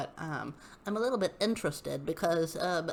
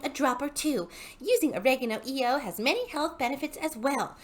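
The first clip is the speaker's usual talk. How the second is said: higher, preachy sales-pitch voice; Hunbot voice; 5 semitones higher than usual. Acted. Reading the hun's post aloud, in a smug higher sales voice. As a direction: read it like a smug MLM hun. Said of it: "the Hunbot voice".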